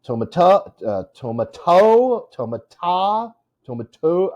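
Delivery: high, "tomato" as toe-muh-TOE; experimenting, "tomato" with a high stretched final syllable; stress on last syllable. Shown as a demonstration. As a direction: try out "tomato" with the end punched, varying the last vowel. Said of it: "the last".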